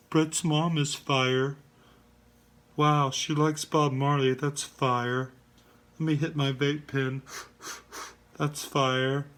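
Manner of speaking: mocking a smug stoner-like host; smug Brian Davis. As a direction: play a smug guy who thinks everything is fire. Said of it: "He talks like this".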